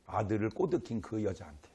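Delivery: ordinary delivery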